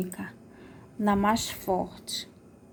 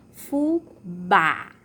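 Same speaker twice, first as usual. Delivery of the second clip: "fubá" split slowly, long strong BÁ; "fubá" with a drawn-out open final á; stressed final syllable; 3 semitones lower, 3 dB louder than usual. Shown as a demonstration. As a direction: lean on the BÁ of "fubá". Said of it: "a sílaba tônica é o bá".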